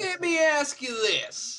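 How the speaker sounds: very high, slow Southern drawl; exaggerated high-pitched Southern drawl; Southern accent. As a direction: stretch it out in a hammed-up Southern accent. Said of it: "if I can do a good Southern accent".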